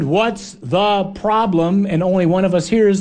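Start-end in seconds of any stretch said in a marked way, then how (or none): 0.0-1.9 slow, easy question; drawn out and unworried; relaxed Jesus. Acted. Jesus answering the frightened disciples, slow and easy. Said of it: "and Jesus answered".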